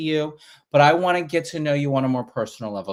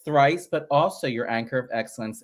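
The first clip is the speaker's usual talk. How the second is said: show host intro; 2 semitones higher, 5 dB louder than usual. Acted. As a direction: open the livestream like a pageant emcee. Host opens Facebook live show.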